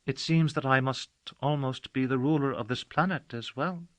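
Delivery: a sly little smile in the words; an impish girl teasing the stranger. Acted. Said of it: "She smiled almost impishly and added".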